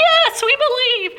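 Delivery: high, eager crowd voice answering together. Retold or imitated. Crowd answering the acrobat's question, high and eager. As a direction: play the whole crowd chiming back. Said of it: "And of course they're like".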